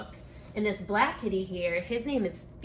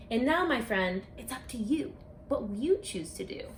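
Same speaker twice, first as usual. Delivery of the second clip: lively sing-song picture-book read-aloud; reading to children. Acted. Author reading her rhyming book to children, storytime voice. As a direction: read it like storytime for a class of kids.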